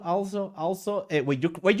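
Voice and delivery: ordinary delivery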